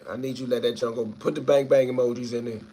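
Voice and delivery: normal talking voice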